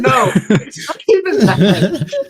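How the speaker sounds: high, loud, slow words through laughter; laughing. Real, not acted. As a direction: laugh through the whole line.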